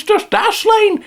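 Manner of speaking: hammed-up sales pitch. Ad pitch punchline.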